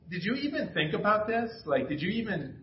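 high, indignant mock complaint; a hint of a let-down teen; imitating daughter. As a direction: play the hurt daughter, half-joking. Voicing disappointed daughter.

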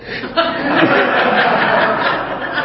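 a high airy giggle at himself; high breathy laugh. Real, not acted. Preacher laughing at the child's joke on him. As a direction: laugh it off lightly.

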